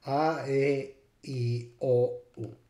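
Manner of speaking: A, E, I, O, U as pure Latin vowels ah, eh, ee, oh, oo; Latin-style A, E, I, O, U; pre-shift vowel sounds. Shown as a demonstration. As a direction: recite A, E, I, O, U the old continental way. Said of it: "before the Great Vowel Shift".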